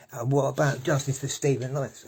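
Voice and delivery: loud protest cry across the park; retold shout. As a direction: belt it from the bench like a protester. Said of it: "I shouted out".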